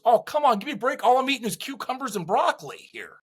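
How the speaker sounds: mock pleading whine, give me a break; exasperated protest. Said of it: "he goes".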